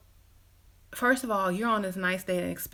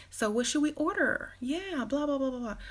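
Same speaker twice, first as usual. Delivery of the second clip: bubbly date chatter; 7 semitones higher than usual. Acted. Too-nice date chatter.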